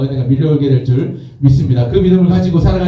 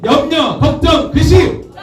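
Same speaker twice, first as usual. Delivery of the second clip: high, urgent crowd cue; climbing call, word by word; rallying call; 10 semitones higher, slower than usual. Real, not acted. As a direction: fire up the congregation to answer. Repeated cue for shouted response.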